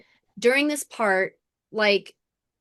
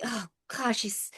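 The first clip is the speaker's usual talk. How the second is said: breathy unvoiced whisper; 8 dB quieter than usual. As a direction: whisper it under your breath. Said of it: "And she's like".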